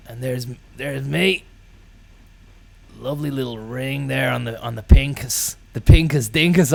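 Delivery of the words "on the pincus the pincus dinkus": pincus dinkus, said loud and proud; pet name said proudly. Shown as a demonstration. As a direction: say pincus dinkus with a mock-grand flourish. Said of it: "I call it".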